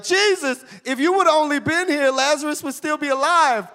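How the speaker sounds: high, pleading mock lament; lightly voiced weeping sister; wailing sisters.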